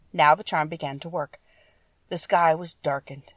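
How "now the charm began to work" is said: usual speaking voice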